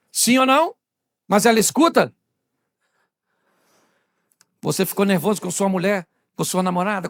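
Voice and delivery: ordinary delivery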